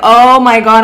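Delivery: indignant loud outburst; loud exasperated protest. Retold or imitated. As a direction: burst out defending yourself. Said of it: "And I'm always like".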